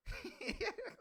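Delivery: sudden high-pitched laugh; high burst of laughter. Real, not acted. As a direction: break into a squeaky laugh. Laughs at a rude comment.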